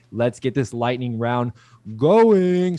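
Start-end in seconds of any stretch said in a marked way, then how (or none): 2.0-2.8 big excited kickoff, loud and high; hyped-up shout. Real, not acted. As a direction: launch it like a hype man. Kicking off the lightning round.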